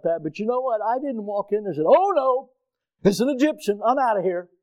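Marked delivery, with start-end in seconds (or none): 1.9-4.5 mock alarm. Hypothetical prejudiced patient reaction.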